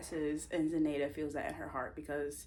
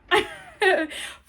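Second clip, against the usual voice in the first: high laugh; 14 semitones higher, 11 dB louder than usual. Real, not acted. Laughing at own joke.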